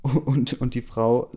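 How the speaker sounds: ordinary delivery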